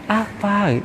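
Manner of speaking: softly voiced imitation of a gentle talker; soft-spoken. Preacher imitating a gentle friend, soft voice. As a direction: say it softly, as a meek speaker would. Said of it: "Ada yang lemah lembut".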